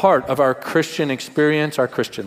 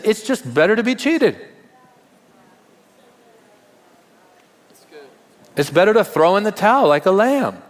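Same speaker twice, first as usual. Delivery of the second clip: fervent sermon climax; impassioned preaching; 6 semitones higher than usual. Acted. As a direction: drive it home from the pulpit, rising. Preaching rising to full force.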